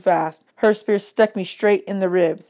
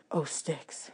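much softer, lower curse under the breath; muttered curse; 4 semitones lower, 17 dB quieter than usual. Acted. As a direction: curse quietly to yourself. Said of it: "she cursed".

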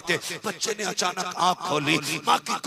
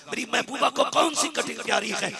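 ordinary talk, nothing marked

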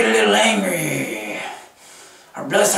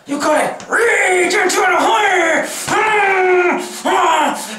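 building rage; 11 semitones higher than usual. Shown as a demonstration. Coaching the homies through the rage face, shouting higher. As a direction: whip yourself up into a shouting rage. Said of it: "This one takes a minute, homie, to get it all out".

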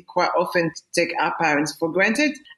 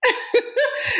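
bright burst of laughter; high giggle. Real, not acted. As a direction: laugh at yourself, high and quick. Giggles at her own accent.

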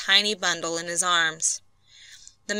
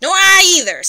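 loud, higher gruff agreement; growled; 11 semitones higher, 9 dB louder than usual. A narrator's reading. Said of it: "growled Skid".

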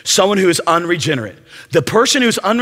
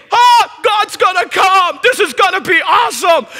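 thrilled like God is about to appear; hammed-up excited cry; 12 semitones higher, 3 dB louder than usual. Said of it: "So he's thinking".

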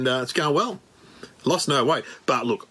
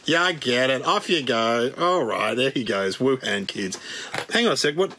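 ordinary delivery

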